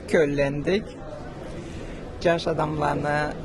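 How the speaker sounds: ordinary talk, nothing marked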